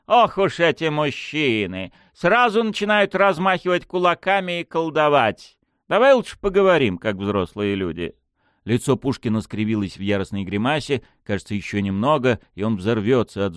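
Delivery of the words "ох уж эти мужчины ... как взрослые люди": high-pitched, teasing exasperation; amused condescension. A narrator's reading. Lady answers his show of force, amused condescension. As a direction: tease him like a silly boy. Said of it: "Дмитриева лишь рассмеялась в ответ".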